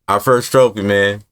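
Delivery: normal talking voice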